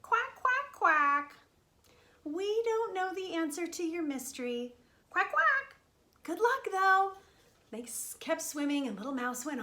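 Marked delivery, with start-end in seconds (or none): 0.0-7.1 high, loud quacky voice; quack, then speak in a raised duck voice; talking ducks. Acted. Ducks don't know the answer, high quacky reply. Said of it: "said the ducks".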